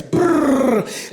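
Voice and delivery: ordinary talk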